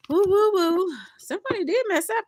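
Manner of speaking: high, loud whooping; excited hoot. Real, not acted. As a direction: hoot at the reveal. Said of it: "reacting to cards".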